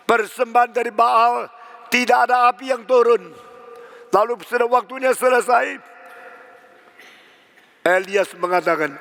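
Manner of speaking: ordinary talk, nothing marked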